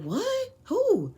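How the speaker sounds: high, loud shocked "What? Who?"; shocked disbelief. Retold or imitated. Hearing her child's dad say a friend shot someone, shocked high cry. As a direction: shriek it in disbelief. Shocked reaction to shooting news.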